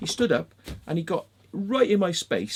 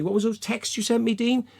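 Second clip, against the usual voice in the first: ordinary delivery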